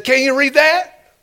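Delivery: high question, rising at the end; challenging official. Retold or imitated. Officials putting their last paper in front of him, mock challenge. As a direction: put on the official and dare him, voice climbing.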